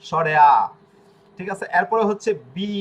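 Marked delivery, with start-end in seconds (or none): 0.1-0.5 স্বরে আ, an open Bengali আ sound; Italian vowel a. Shown as a demonstration. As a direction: say আ clearly and loudly, not the English এ. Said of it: "ইংরেজি ভাষায় হচ্ছে এ".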